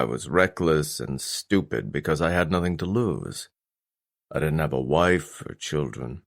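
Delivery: low, measured voice of a remorseful father; father's low, sober confession; stern father, confessional. Acted. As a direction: own up to a reckless past in a low register.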